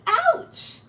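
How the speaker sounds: high, loud yelp of pain; sharp high cry of pain; pained ouch. Acted. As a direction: squeal it like something pricked you. Ratty pricked again.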